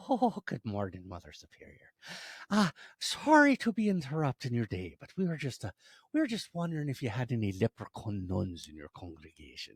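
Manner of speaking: polite, lilting leprechaun voice. Acted. Leprechaun asking Mother Superior at convent door, polite lilt. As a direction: play a courteous little leprechaun caller.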